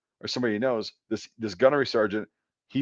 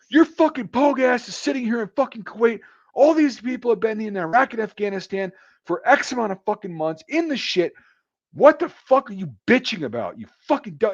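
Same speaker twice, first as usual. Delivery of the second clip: high, loud tearing-into-her voice; angry rant; 12 semitones higher, 3 dB louder than usual. Retold or imitated. Gunnery sergeant chewing out a specialist, angry yelling. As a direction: chew her out hard. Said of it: "starts unloading on her".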